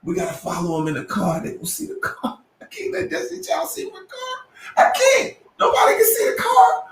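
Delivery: high, fast, frantic worry; panicked. Retold or imitated. A host dreading the stars seeing his car, high frantic panic. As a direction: squeal it in rising panic. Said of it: "I said".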